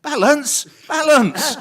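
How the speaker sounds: high, loud "balance, balance" rising; shouted high warning echoing in the head. Retold or imitated. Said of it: "in my head".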